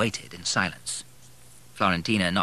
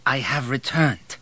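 slow, higher, mildly insistent; 5 semitones higher than usual. A narrator's reading. Returning son to a mother who ignores him, mildly insistent. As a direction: press it slowly with a touch of insistence. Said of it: "more insistently".

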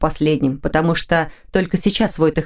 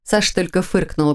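ordinary delivery, nothing marked

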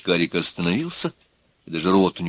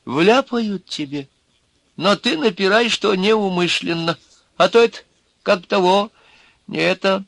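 sympathetic little man; 12 semitones higher than usual. A narrator's reading. Wizened little man advises the accused, sympathetic. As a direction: counsel him in a high, halting voice. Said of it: "сочувственно протянул маленький сухонький человечек".

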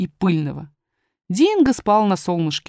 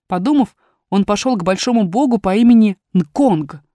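plain reading voice

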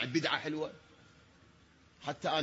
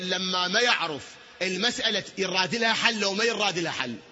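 ordinary delivery

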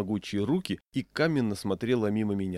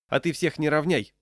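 raised, loud put-down; curt rebuke; 6 semitones higher, 5 dB louder than usual. A narrator's reading. Cloakroom man checks the angry diner, curt rebuke. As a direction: cut him short firmly. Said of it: "обрезал меня гардеробщик".

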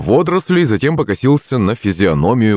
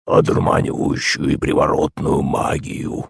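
quiet, low creature voice; low monster voice; 4 semitones lower, 4 dB quieter than usual. A narrator's reading. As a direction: murmur like a swamp beast. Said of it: "ответил он".

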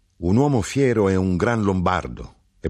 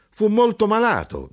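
much higher and louder, insisting hard; emphatic insistence; 4 dB louder than usual. A narrator's reading. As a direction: lift it high to insist he was gravely ill. (con forza, molto più acuto)

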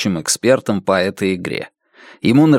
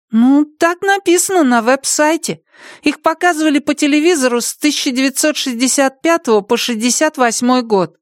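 put-on child voice; 14 semitones higher than usual. Boy answers.

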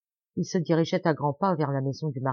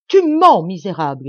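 howled; 12 dB louder than usual. A narrator's reading. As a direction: roar the accusation at a liar. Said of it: "hurla-t-il".

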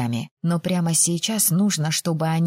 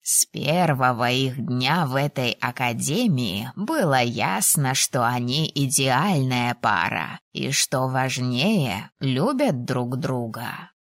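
low, slow, lordly voice; measured older lord, pitched low; condescending dean; 5 semitones lower, slower than usual. A narrator's reading. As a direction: explain it with unhurried, lowered authority. Dean's dialogue in narration.

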